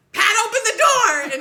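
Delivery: yelling. Retold or imitated. Dad yelling at his wife to open the car door, full yell. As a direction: scream it, fingers caught. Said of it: "and then my dad goes".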